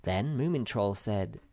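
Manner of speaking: ordinary delivery, nothing marked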